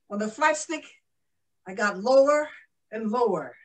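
ordinary delivery

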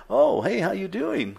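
high, falsely cheery boss voice; mock-innocent greeting. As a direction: play the sly boss acting like nothing happened. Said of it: "just with a straight face".

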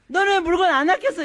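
mock scolding. Mother scolds son for not caring for his things, mock nagging. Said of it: "이러면 얘가 막 짜증이 나고".

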